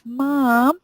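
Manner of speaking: high whining "Mum"; mock kid whine. Child calling mum to ask for something, wheedling.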